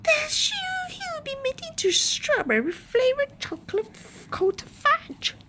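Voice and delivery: very high, loud tearful scream, stumbling; mother screaming that her son will become fudge; screaming in horror. Acted. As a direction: she is horrified, voice cracking. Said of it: "screamed Missus Gloop".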